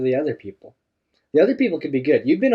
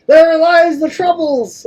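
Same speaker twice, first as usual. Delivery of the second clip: loud, slow, high hammed-up pirate; bad pirate voice; 11 dB louder, much slower than usual. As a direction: roar it like a cartoon pirate. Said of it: "Okay, pirate voice. Bad".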